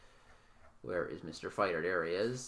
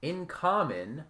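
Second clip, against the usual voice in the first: ordinary talk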